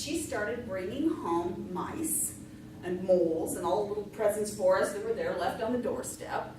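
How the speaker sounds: ordinary delivery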